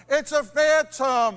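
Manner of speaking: loud, drawn-out frightened shout; terrified cry. Acted. Disciples seeing a figure on the lake, terrified shout. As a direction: stretch it out in terror. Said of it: "and cried out in fear".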